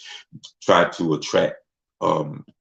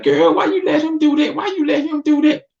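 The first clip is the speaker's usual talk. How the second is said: squeaky high scolding friend; high mock friend voice; 4 dB louder than usual. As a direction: mimic a nagging girlfriend, pitched way up. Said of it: "people trying to talk sense".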